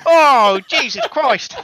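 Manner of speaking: high, loud groaning exclamation; exasperated exclaim. Real, not acted. Friend caught out by teasing, loud high exasperation. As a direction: throw up your hands with a yelp.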